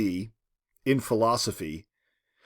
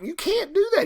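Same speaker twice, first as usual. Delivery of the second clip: shocked protest. Retold or imitated. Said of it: "she's shocked and stunned".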